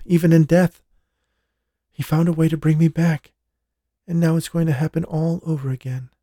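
lightly voiced young woman in fear; a woman pleading about her lover; frightened woman. A narrator's reading. Jessica voiced as character.

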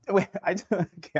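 laughing in disbelief. Real, not acted. Stunned by the budget news.

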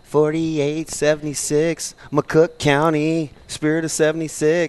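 a lightly sung-out high, slow call-in number; hammed-up ad voice, drawn out high; playful jingle read; mock radio jingle.

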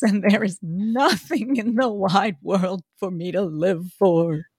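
slow, theatrical grieving wail; breaking down crying. A narrator's reading. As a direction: wail it out in drawn-out, hammed-up despair. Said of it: "Here he suddenly broke down ... crying".

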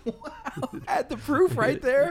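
high, laughing wow; laughing disbelief. Real, not acted. Host laughing at the pitcher's story, high and amused.

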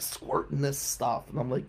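normal talking voice

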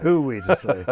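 amused echo of the host; a laughing, knowing repeat. Real, not acted. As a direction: repeat the line through a chuckle. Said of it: "echoing with a laugh".

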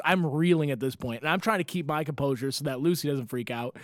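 normal talking voice

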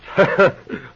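a bright, tickled laugh; chuckling at his own cleverness; gleeful laugh. Acted. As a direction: laugh as the appetite comes back. Gloating over the trick.

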